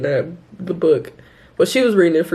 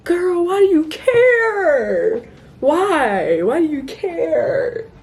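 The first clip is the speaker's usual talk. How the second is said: high, drawn-out whine; hammed-up, whiny exasperation; exasperated mock wail; 9 semitones higher, 5 dB louder, much slower than usual. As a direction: wail it in exaggerated disbelief, stretching the words. Reader mock-wails at her own character.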